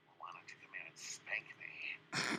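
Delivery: slow, low, soft whispered tease; flirty whisper. Pretend. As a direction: whisper it slowly as a playful come-on. Flirty teasing in roleplay.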